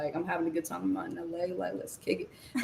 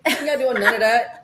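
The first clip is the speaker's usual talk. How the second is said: louder and higher, laughing through it; 5 semitones higher, 5 dB louder than usual. Real, not acted. Guest laughing in agreement.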